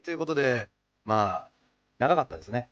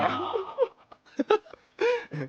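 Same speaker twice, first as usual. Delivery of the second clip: laughing drawn-out disbelief; much slower than usual. Real, not acted.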